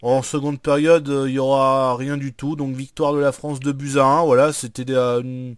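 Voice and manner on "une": ordinary delivery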